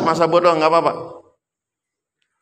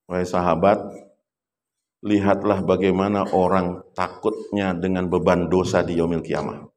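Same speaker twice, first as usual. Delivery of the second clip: low, soft and solemn, gently teaching; a hushed grave voice telling his companions; solemn Prophet speaking; 9 semitones lower, 3 dB quieter than usual. Retold or imitated. Prophet's words told in story.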